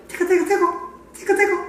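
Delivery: high-pitched little tickle chant; play-tickle in a small, high voice; playful tickling. Pretend. Host showing off a brush, high playful tickle voice.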